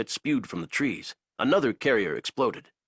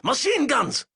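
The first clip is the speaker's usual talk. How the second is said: high, loud command; shouted order; 4 dB louder, slower than usual. Acted. Zayson ordering machine guns, high loud bark. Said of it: "Zayson ordered".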